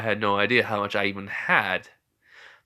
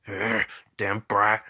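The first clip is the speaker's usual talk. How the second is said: low-key slow growl, softer; grumbling beast Kurama; 3 semitones lower, 5 dB quieter, slower than usual. Acted. Kurama grumbles back at Naruto, soft slow growl. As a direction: grumble like a grudging monster. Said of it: "and Kurama was like".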